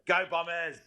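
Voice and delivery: high shouted Go Bombers; team cheer. Real, not acted. Caller signing off his voicemail with a high shouted cheer. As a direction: cheer the club out loud.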